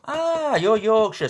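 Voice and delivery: high, loud mock Yorkshire accent; hammed-up attempt at a Yorkshire accent; a clumsy go at a Yorkshire accent; bad Yorkshire accent. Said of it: "That was the worst Yorkshire accent ever".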